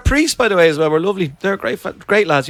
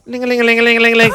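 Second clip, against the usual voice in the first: fast, higher sing-song ling-a-ling of altar bells; imitated bell ringing; 5 semitones higher, 4 dB louder, much faster than usual.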